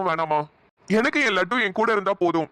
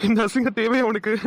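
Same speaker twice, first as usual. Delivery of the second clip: laughing tease; 2 semitones higher than usual. Acted. Teasing laugh.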